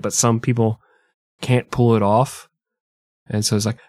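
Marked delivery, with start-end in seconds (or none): none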